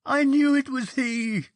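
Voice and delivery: sudden high, loud yelp. A narrator's reading. Marchbanks, seeing something unseen, yelps high and loud. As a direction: yelp it out like a shot animal. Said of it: "he yelped in a high voice".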